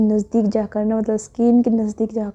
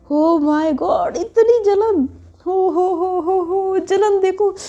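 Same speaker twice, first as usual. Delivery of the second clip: much higher mock gloating, slow oh my God; 8 semitones higher, much slower than usual. A narrator's reading. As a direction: taunt her jealousy with fake shock. Cousin mocks Preet's jealousy. (ऊँची आवाज़ में चिढ़ाते हुए)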